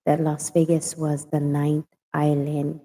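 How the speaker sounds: ordinary delivery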